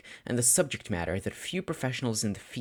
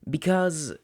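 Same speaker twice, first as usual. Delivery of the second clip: unsure pause; 7 semitones higher, 4 dB louder than usual. A narrator's reading. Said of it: "he paused, unsure of what to say".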